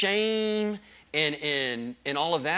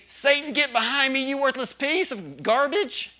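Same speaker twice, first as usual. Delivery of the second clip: loud, high defiant rebuke; preacher shows how to shout down Satan, loud and high; rebuking Satan; 9 semitones higher, 4 dB louder than usual. Pretend. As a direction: tell the devil off with full force. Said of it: "You say".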